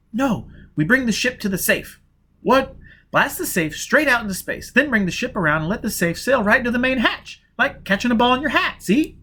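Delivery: put-on a young woman pitching a daring plan; Jiz, urgent plan. Jiz urging Foyle to blast the safe, eager woman's voice.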